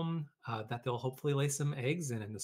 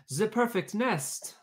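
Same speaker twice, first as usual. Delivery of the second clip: slow, high voice, the said as ze; duck with French accent; 9 semitones higher, 5 dB louder, slower than usual. Acted. Duck admiring the nest, slow high French accent. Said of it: "she cried".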